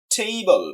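Table with a long TAY and falling second syllable; first-syllable stress. Shown as a demonstration. Teacher giving a stress example, clearly emphasized. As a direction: lean on the start of table. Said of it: "প্রথম সিলেবলটাকে stress দেওয়া হয়েছে".

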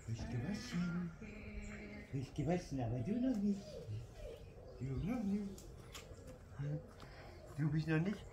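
hushed, tender words to a pet. Real, not acted. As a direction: speak softly to a puppy.

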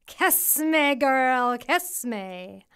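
a strange head pleading from the water; eerie creature voice. A narrator's reading. Third head asks.

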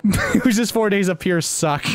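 laughing punchline. Real, not acted.

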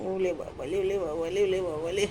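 soft, low cooing. Real, not acted. Cooing to the dog.

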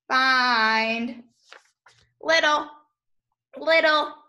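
ordinary talk, nothing marked

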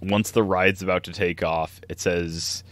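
ordinary talk, nothing marked

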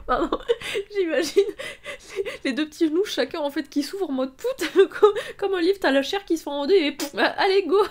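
high, loud words broken by laughter; real laughing fit. Real, not acted. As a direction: try to explain while helplessly laughing. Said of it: "je devrais pas rire". (vrai fou rire en parlant, aigu)

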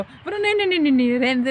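high, loud panicky refusal; son's panicked no. Retold or imitated. Son refusing his mother's scrubbing, high panicked protest. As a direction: squeal no no like a scared boy. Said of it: "son panics, refuses teeth scrubbing".

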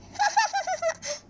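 high mocking cackle; mocking laugh. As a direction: laugh in her face. Mocking the princess claim.

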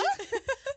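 loud high shriek of laughter; helpless high laugh; high burst of laughter. Real, not acted. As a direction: squeal with laughter. Laughing at own mistake.

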